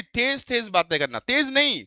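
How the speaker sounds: normal talking voice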